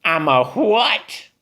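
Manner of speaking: high, mocking sneer; sneering witch. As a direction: sneer it back at them. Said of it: "Beulah sneered at the crowd".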